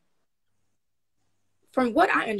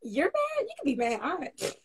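high, mock-dismissive you can be mad; sassy shrug; 6 semitones higher, 3 dB quieter than usual. Shrugging off upset friends, sassy and high. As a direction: brush off their anger playfully. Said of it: "I'm like".

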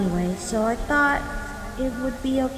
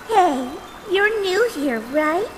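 ordinary talk

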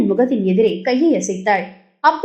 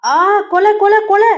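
high, loud mock cry for help; hammed-up cry of murder while laughing; playful shout of murder; laughing mock yell; 10 semitones higher, 4 dB louder than usual. A narrator's reading. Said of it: "சிரிப்புடன் கத்தினான்".

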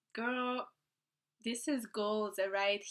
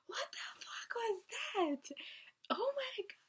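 breathless whispered disbelief; hushed amazement, barely voiced; stunned whisper; 12 semitones higher, 5 dB quieter than usual. Real, not acted. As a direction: whisper it in awe. Stunned whisper after video.

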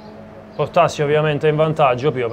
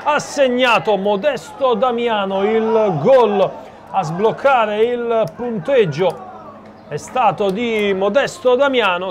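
breathless italian goal commentary; 6 semitones higher than usual. Real, not acted. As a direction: erupt as the ball hits the net. Goal scored, commentator erupts.

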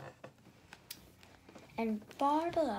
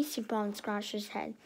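ordinary delivery, nothing marked

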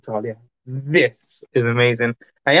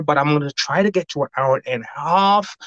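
ordinary delivery

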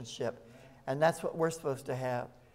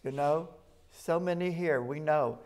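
solemn psalm reading, swelling louder on key lines; scripture reading; 4 dB louder than usual. Acted. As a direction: read the psalm as proclamation to the congregation. Reads Psalm twenty-three aloud.

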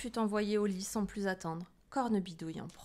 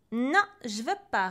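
tag; 3 semitones higher, 9 dB louder than usual. A narrator's reading. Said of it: "rétorqua Pierre en bâillant".

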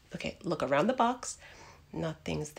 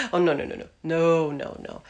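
panicked; 5 semitones lower, 5 dB louder, faster than usual. Acted. Panicked refusal.

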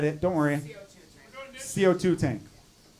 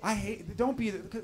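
ordinary talk

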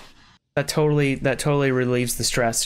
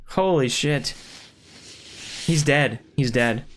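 ordinary delivery

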